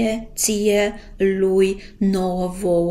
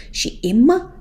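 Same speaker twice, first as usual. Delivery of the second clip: "im" said higher and louder, closing firmly on the m; stressed final m; 6 semitones higher, 8 dB louder than usual. Shown as a demonstration. Teacher contrasts "im" with "in" for students, loud clear m. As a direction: hit the m at the end of "im". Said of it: "faceți deosebirea dintre in".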